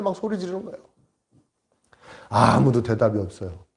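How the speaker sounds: ordinary talk, nothing marked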